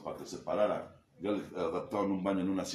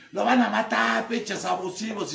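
high, loud retold yell of street mothers; 12 semitones higher, 9 dB louder, slower than usual. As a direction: shriek it out to stop the beating. Las jefitas gritan defendiéndolo. (grito alto y fuerte de las señoras)

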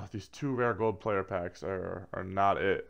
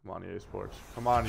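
usual speaking voice

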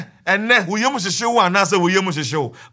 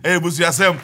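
normal talking voice

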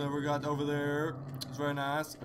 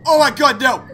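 loud, high yelp of fright; high-pitched shout of alarm; startled scream; 10 semitones higher, 18 dB louder than usual. Real, not acted. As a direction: cry out in sudden panic. Jump scare.

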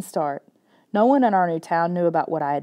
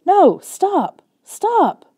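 desperate shout; 12 semitones higher, 7 dB louder, much slower than usual. Acted. Dog bolts after squirrel.

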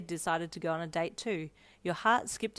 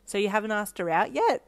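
louder surprised question; 3 semitones higher, 7 dB louder than usual. A narrator's reading. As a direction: ask with surprise. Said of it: "your eyes widening in surprise".

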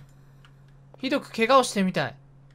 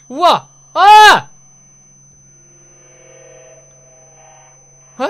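very loud, high shriek; real fright; startled scream; 6 semitones higher, 14 dB louder, much slower than usual. Real, not acted. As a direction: scream caught off guard. Jump scare.

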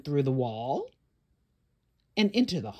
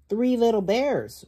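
louder refrain; 6 semitones higher, 8 dB louder than usual. A narrator's reading. Storyteller loudly opening the refrain. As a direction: call it out higher and louder. Loud refrain in read-aloud.

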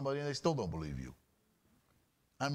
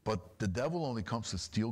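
usual speaking voice

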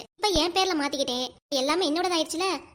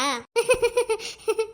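mocking laugh; 7 semitones higher than usual. Wife mocks husband's sad face.

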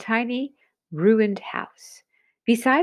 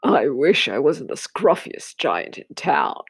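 low, wistful giant voice; sad giant; 6 semitones lower than usual. A narrator's reading. Scruffy giant wishing sadly he looked better. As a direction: sigh it out like a lonely giant. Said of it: "he said sadly".

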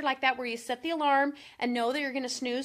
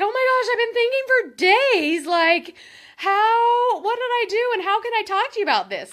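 much higher and louder, gushing relief; relieved husband; 9 semitones higher, 11 dB louder than usual. Retold or imitated. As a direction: pour out the husband's relief all at once. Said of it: "so relieved".

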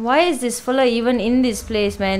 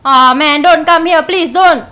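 louder, higher begging; scared pleading; 5 semitones higher, 6 dB louder than usual. Real, not acted. Frightened plea. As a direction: player begging the monster to stay away. Begging monster not to come.

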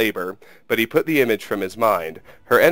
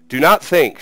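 raised, higher voice of a commanding queen; sharp cry; 6 semitones higher, 7 dB louder than usual. A narrator's reading. Queen in pain cutting off her war chief, crying out. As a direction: cry it out as an urgent order. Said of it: "she cried".

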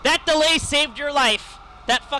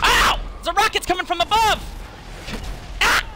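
startled yell; 9 semitones higher than usual. Real, not acted. Warning his friends about rockets, startled high yell. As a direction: yelp as the rocket lands.

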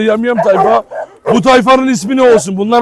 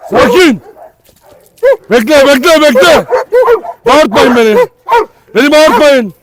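loud, high repeated commands; urgent shouting at dogs; 9 semitones higher, 5 dB louder than usual. Real, not acted. Yelling at attacking dogs.

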